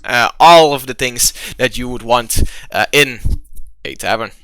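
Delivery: grand, emphatic tavern welcome; game master scene setting. Acted. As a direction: announce each delight with mounting showmanship, then settle.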